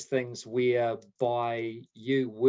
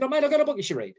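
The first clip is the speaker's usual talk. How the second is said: eager mate voice, much higher and louder; imitating a keen friend; 6 dB louder, faster than usual. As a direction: pitch it up like an excited recommender. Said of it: "he said".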